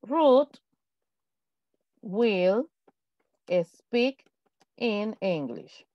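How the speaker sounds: spaced words, pauses between each; slow dictation. Shown as a demonstration. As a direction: dictate word by word. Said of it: "estamos seguros". (dictado pausado palabra por palabra)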